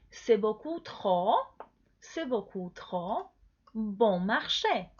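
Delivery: "C'est beaucoup trop bon marché" slowly, in separate chunks; slow, measured teacher's model of "bon marché"; pronunciation model. Shown as a demonstration. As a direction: draw out "trop" and pause before "bon marché". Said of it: "Cada vez repita conmigo la pronunciación".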